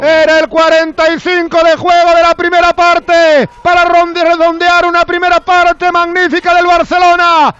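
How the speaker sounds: high, loud shouted replay call; excited goal recap. Real, not acted. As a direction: keep shouting through the replay. Goal recap at full force.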